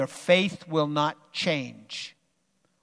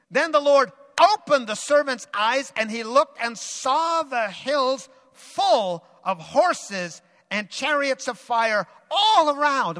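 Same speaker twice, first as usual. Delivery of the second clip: higher, louder, slow shouted wonder; awed climax; 6 semitones higher, 4 dB louder than usual. Acted. Preacher at the climax of the vision, slow awed shout.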